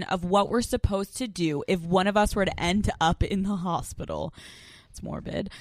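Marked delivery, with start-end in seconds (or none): 4.9-5.5 whispered aside. Real, not acted. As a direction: breathe it under your breath.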